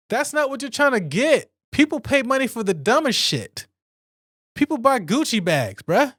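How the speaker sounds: high, heated disbelief at the question; exasperated rant. Real, not acted. Ranting to viewers about a pointless question, high and exasperated. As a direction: rant it up high like it's ridiculous.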